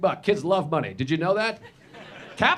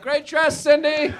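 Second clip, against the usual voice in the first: put-on teen boy faking casual; 8 semitones higher, 3 dB louder, slower than usual. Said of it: "be like".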